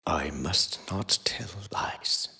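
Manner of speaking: breathless slow whisper. Acted. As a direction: hush it to a thread, slowly. Quoting a painful memory.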